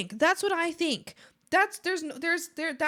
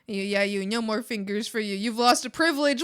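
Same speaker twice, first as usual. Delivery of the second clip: normal talking voice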